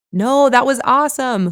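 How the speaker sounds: loud, high, gleeful; delighted. A narrator's reading. Friend gushing about the public kiss, loud and delighted. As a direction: crow with delight.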